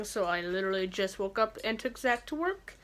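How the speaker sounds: ordinary talk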